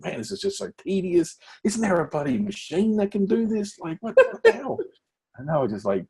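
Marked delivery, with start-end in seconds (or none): 0.0-4.8 exasperated inner grumble. Retold or imitated. Young worker venting about tedious metal cutting, exasperated. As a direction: grumble about the tedium like it's driving you mad. Retold frustration at tedious job.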